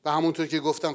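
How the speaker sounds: ordinary talk, nothing marked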